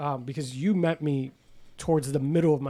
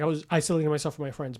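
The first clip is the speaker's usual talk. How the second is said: ordinary talk, nothing marked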